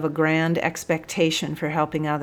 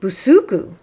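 sergeant's roar; 3 semitones higher, 7 dB louder than usual. A narrator's reading. Sergeant summoning Busuku from his cell, roaring. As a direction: roar it out at a prisoner. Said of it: "roared the sergeant".

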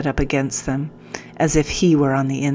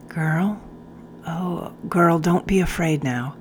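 softer, tender coaxing; gentle reassurance; 6 dB quieter than usual. Acted. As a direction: soothe her quietly. Sergeant comforts wounded girl.

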